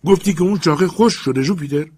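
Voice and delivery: high, loud cry of alarmed excitement; trembling excited shout. Acted.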